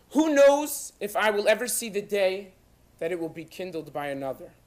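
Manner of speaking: loud, deliberate declamation with long pauses, easing at phrase ends; impassioned last letter read aloud; solemn letter reading. Acted. [name] begins to read as follows.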